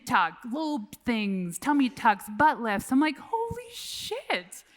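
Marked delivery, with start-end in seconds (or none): 3.2-4.6 much higher, slow and soft, amazed; stunned holy shit. Retold or imitated. Reacting to friends' surgery confessions, slow and amazed. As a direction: draw it out in disbelief.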